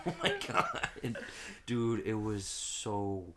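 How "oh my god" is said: high squeal. Retold or imitated. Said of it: "I was just like".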